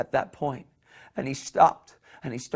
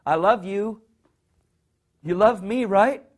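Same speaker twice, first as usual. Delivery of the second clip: higher and louder, seeking reassurance; needy pleading love; 7 semitones higher, 6 dB louder than usual. Pretend. Needy lover demands love back, high and pleading. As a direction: beg to hear it said back.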